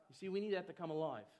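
ordinary delivery

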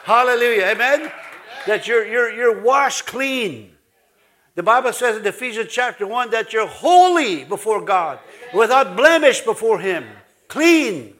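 emphatic revival-style preaching; full-force preaching. Real, not acted. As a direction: preach it out to rouse the congregation.

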